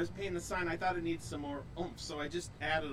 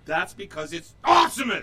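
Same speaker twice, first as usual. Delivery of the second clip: loud; 11 dB louder than usual. Acted. Caught in announcer loop.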